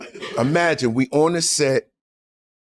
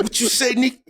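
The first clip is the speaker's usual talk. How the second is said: put-on old man challenge; 9 semitones higher than usual. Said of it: "he said".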